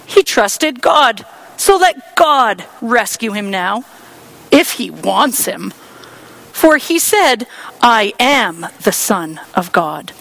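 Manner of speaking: high, loud sarcastic jeering; mocking. Priests mocking Jesus on the cross, sarcastic jeer. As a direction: rub in the taunt. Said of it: "the elders also mocked Jesus".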